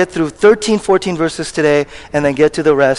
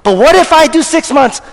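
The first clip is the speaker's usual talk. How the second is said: full-force preaching; 6 semitones higher than usual. Acted. Preacher to congregation on no condemnation, impassioned preaching. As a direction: drive it home with every word.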